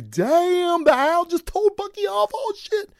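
very high, squeaky amazed voice; mock falsetto exclaim. Reviewer retelling his reaction to the comic, squeaky falsetto. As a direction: shriek it like a stunned fanboy. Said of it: "I was like".

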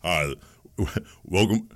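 imitating phone greeter. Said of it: "Let me start over".

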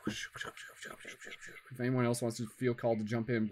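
soft unvoiced whisper. Real, not acted. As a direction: whisper the invitation gently. Inviting others softly to join.

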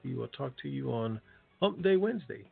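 ordinary talk, nothing marked

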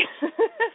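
short, high giggle at herself; bubbly little laugh. Real, not acted. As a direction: laugh at your own slip. Laughs at her own slip.

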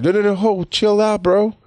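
high-pitched casual intervening voice; comic high "whoa, easy" imitation; Jesus. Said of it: "And Jesus is like".

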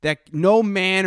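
a hint of a stern father laying down the law; stern father quote. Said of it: "his father tells him, he says".

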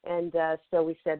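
ordinary talk, nothing marked

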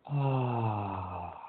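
long audible sigh out; loud exhale sigh. Shown as a demonstration. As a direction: breathe out with a big sigh. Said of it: "exhale with a loud sigh".

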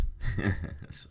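short laugh at own silliness. Real, not acted. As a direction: laugh at your own bad impression. Laughs at own bad accent.

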